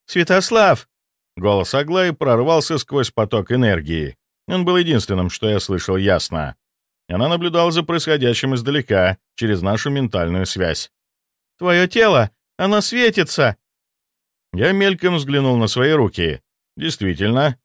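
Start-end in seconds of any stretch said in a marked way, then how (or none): 0.1-0.9 high loud call of a name. Acted. Aglaya calls out to hero. 11.6-13.6 high startled cry; alarmed exclamation. Acted. Aglaya alarmed at glowing body.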